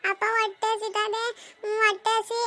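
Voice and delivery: slow, hungry whimper; tearful whining. Acted. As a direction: plead with father, dragging the words. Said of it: "ಅಳ್ಬೇಡ". (ಹಸಿವಿನಿಂದ ಅಳುತ್ತಾ ಕೇಳುವುದು)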